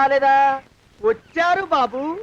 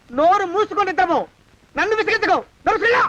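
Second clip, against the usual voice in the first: rising angry order; 6 semitones higher than usual. Acted. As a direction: voice climbing higher with each order. Snaps at the servant.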